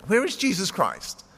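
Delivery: a touch of pleasant projection; loud, pleasant asking. A narrator's reading. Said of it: "He asked with his loud, pleasant voice".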